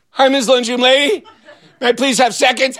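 high, laughing put-on innocence; nervous sweet kid. Kid avoiding lunch lady's eyes, high nervous sweetness. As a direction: sound innocent while hiding guilt.

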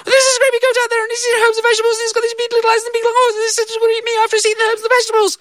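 put-on very high, rushed panicked girl; gabbling child. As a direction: blurt it all out too fast. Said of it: "You're talking too quickly".

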